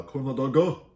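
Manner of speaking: exaggerated Korean-accented corn dog; mock Korean accent. Shown as a demonstration. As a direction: draw out "dog" with an added vowel, mock Korean. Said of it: "you say it with Korean accent".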